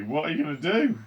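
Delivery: high, loud, distraught question; wailing. A narrator's reading. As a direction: wail the question. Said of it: "wailed Missus T V".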